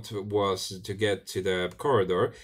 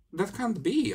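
incredulous cry; 12 semitones higher, slower than usual. A narrator's reading. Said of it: "I cried".

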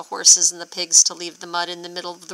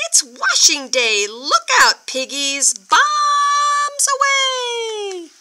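high, drawn-out gleeful shout; excited cry; 14 semitones higher, 3 dB louder, slower than usual. Acted. Farmer in his plane shouting at the piggies, gleeful. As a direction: cry Bombs away as you dive. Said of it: "Farmer cried".

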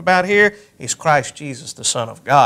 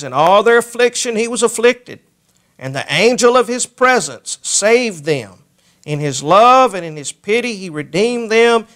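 preaching at full force; 4 semitones higher than usual. Acted. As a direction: proclaim the warning from the pulpit.

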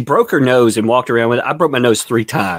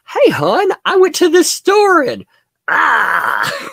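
much higher, mocking squeaky voice; mock shrill partner. Streamer imagining life with her, high mock squeal. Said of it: "Could you imagine dealing with her".

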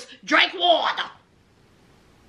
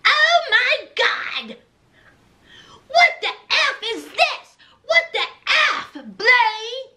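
higher, laughing mock outrage; hammed-up shock, higher; Lindsay shocked; 7 semitones higher than usual. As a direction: girlfriend discovering the ankle monitor, mock shocked. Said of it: "Lindsay was like".

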